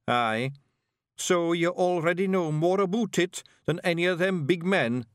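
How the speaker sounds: Scottish character voice, encouraging; encouraging Scottish Feegle leader. Acted. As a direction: play a wee chief bucking up a novice. Rob encourages the young bard.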